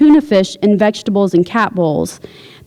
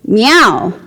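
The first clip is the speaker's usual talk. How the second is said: a challenging, pointed meow; confrontational meow. Acted. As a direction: meow as a dare to your wife. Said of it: "This one was more confrontational".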